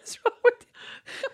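high, slow, laughing exasperated kidnapper; laughing mock exasperation. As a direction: giggle through an exasperated outburst. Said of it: "They'd be like".

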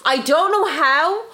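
high, loud, slow outrage; mock outrage. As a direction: drag it out in mock fury.

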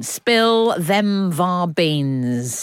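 stretched-out playful words; very slow drawl. Acted. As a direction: drag every word out. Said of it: "drawn-out playful line".

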